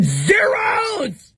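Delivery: theatrical cry of exam despair; mock wail. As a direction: howl it like a hammed-up sob. Mock despair over exam results.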